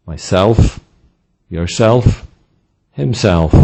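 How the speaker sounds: strong "self": mySELF, yourSELF, himSELF; second-syllable stress. Shown as a demonstration. Teacher giving examples of reflexive pronouns, stressed on the second syllable. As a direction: lean on the "self" in each pronoun. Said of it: "usually stressed on the second syllable".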